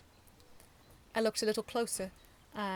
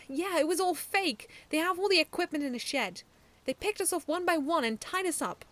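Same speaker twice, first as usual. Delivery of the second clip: put-on child explaining; 9 semitones higher, 6 dB louder than usual. A narrator's reading.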